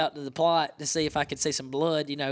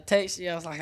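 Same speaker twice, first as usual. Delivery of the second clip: usual speaking voice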